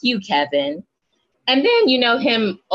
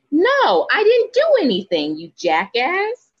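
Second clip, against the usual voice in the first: high-pitched mock indignation; acted-out defensive comeback, starting high; indignant retort; 8 semitones higher than usual. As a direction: imitate an indignant denial, pitched way up. Voices her indignant retort in retelling.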